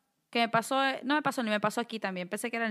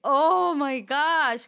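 shocked; 4 semitones higher, 6 dB louder, much slower than usual. Retold or imitated. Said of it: "Y yo me quedé".

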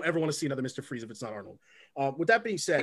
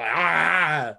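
short mock yell "Ah!", a bit louder; Arnold yelling face noise; 5 dB louder than usual. Imitating Arnold yelling at the kids: mock yell. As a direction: bark out a hammed-up angry yell. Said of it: "I can make random noises".

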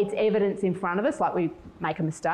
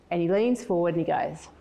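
usual speaking voice

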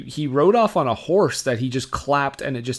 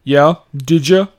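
louder, challenging short questions in a put-on American accent; confrontational; 2 semitones higher, 8 dB louder than usual. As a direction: challenge them curtly. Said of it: "I don't know why I'm so confrontational".

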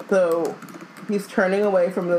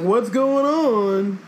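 slow, higher-pitched dopey mock voice; giggling git; 5 semitones higher, 3 dB louder, much slower than usual. Mocking cursed Ron giggling beside his heartbroken friend, clueless git voice. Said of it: "giggling and being like".

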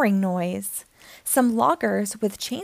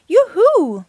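high, loud joyful call; gleeful tree calling out; loud yoo-hoo; 9 semitones higher, 9 dB louder than usual. A narrator's reading. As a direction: shout it across the sky. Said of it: "very loud".

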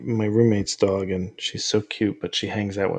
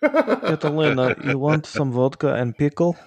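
higher, hammed-up Russian accent with a laugh; 5 semitones higher than usual. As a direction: coo at the dog in a mock Russian accent. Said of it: "I always talk to her with a Russian accent".

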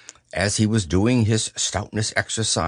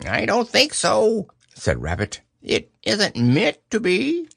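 much higher put-on voice; disguised Rabbit voice; 12 semitones higher than usual. A narrator's reading. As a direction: Rabbit hiding behind a silly voice. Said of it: "in a different sort of voice".

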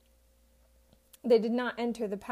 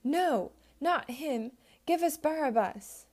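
higher, mob shout demanding Barabbas; shouting crowd demanding Barabbas; crowd shouting back; 5 semitones higher, slower than usual. A narrator's reading. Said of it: "They shouted back".

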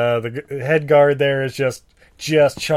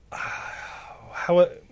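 whisper; 4 semitones higher, 7 dB quieter, much slower than usual. Real, not acted.

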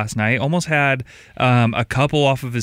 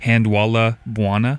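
Handwalla Buana spelled out slowly, each syllable drawn out; slow it right down so every syllable of the name lands; name said slower; slower than usual. Shown as a demonstration. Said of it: "Say his name slower".

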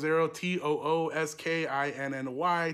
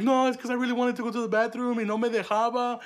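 put-on higher, louder kid's excuse to mom; mock-innocent excuse, pitched up; making excuses; 4 semitones higher, 3 dB louder than usual. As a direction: plead your phony case, switching into Spanish, drawing out the end. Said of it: "I had to cap".

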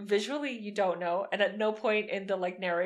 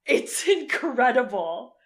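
excited; 4 semitones higher, 8 dB louder, much slower than usual. Real, not acted. Raving about a film.

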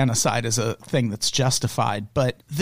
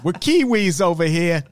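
put-on New Zealand accent; 4 semitones higher than usual. Riffing on New Zealand slogans, mock New Zealand accent. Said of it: "New Zealand accent".

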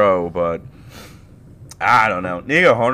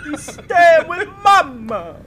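much higher, slow teasing squeak; mock high squeaky voice; 7 dB louder, slower than usual. Mocking the quote back in a high silly voice.

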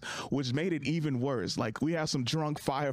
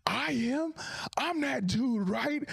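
high, mildly raised call-out, retold; pitched-up retold heckle back; mock yell; 13 semitones higher, much slower than usual. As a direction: jump in with a high cheeky shout. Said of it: "I yell out to him".

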